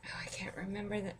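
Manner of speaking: half-whispered, hurried aside. Real, not acted. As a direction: whisper it quickly to yourself. Forgetting the name, whispered aside.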